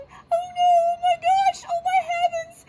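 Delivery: very high, loud squealing little cub; Baby Bear. Acted. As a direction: squeal in panic in a wee tiny voice. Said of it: "he cried in his wee little".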